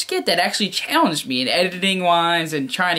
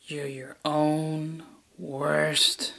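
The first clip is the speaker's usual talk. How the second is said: very slow, softer villain line; slow sinister delivery; 2 semitones lower, 6 dB quieter, much slower than usual. Acted. Villain drags out the line very slowly in a retake. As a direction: stretch every word out.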